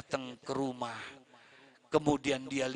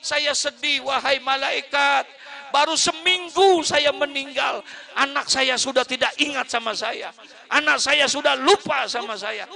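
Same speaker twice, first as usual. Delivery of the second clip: high, loud anguished cry of a forgotten parent; grieving parent; 9 semitones higher, 11 dB louder than usual. Acted. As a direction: wail at the angel. Dead parent answers the angel. (orang tua menangis sedih)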